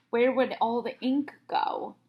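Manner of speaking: usual speaking voice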